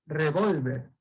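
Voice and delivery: revólver with the O leaned on; stress on middle syllable. Shown as a demonstration. Teacher pronouncing the written accent, stress on VÓL in revólver. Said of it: "la tilde está en la O".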